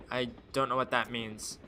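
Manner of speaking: normal talking voice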